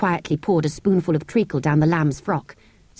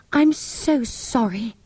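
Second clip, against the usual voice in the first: gently; 5 semitones higher, slower than usual. A narrator's reading. Anthea apologising gently to the cross sand fairy. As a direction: say sorry gently and slowly. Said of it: "said Anthea gently".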